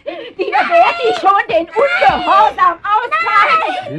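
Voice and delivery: very high, loud, slow scream of rage; furious shout. Acted. Mother screaming at her daughter while beating her. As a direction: scream the threat while lashing out.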